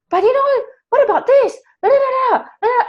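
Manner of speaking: high, squeaky nagging inner voice; whiny ego voice. Retold or imitated. Speaker mimics her ego nagging her, high squeaky voice. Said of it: "the ego came in and said".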